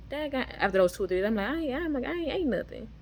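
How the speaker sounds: high-pitched excited realization. Retold or imitated. Telling how they found they hadn't eaten, excited. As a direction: retell it with pitch leaping up in excitement. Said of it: "I was excited".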